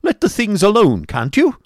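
loud, high scolding shout; cried out. A narrator's reading. Schoolmaster scolding the boy Smike, loud and high. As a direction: bark at the boy to stop. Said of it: "cried Mister Squeers".

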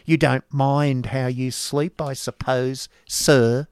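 lightly voiced cold wife, slow; a hint of a disdainful mistress; Mrs Squeers' voice. A narrator's reading. Wife eyeing the new teacher, slow and cold. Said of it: "replied the lady".

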